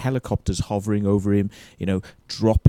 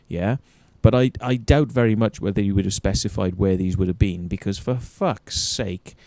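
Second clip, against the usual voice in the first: usual speaking voice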